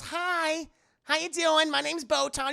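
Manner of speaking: put-on very high, loud bubbly girl; perky girl voice. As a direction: greet him brightly like a chipper girl. Voicing cheerful girl Botan.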